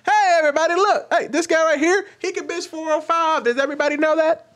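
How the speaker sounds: very high, sustained public call-out; re-enacted yell. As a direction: announce him mockingly to the whole restaurant. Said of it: "I literally yelled out in the restaurant".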